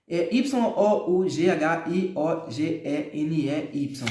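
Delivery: spelling the name letter by letter, slowly; slow letter-by-letter spelling of the company name; spelled out. Shown as a demonstration. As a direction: read each letter of Y O U G H I O G E N E Y separately. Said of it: "Como é que pronuncia isso aqui".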